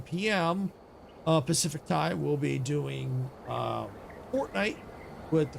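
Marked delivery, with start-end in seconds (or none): none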